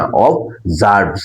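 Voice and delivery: অবজার্ভস slowly, ob-ZARBS, as the right form; slow correct pronunciation. Shown as a demonstration. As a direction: model অবজার্ভস at half speed. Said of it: "উচ্চারণটা কি". (অবজার্ভস ধীরে সঠিক উচ্চারণ)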